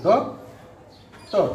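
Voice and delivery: "ডক", long pause, then "টক"; syllable by syllable. Shown as a demonstration. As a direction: break it into two isolated beats, "ডক" and "টক". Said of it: "কয়টি syllable উচ্চারণ করতে পারি".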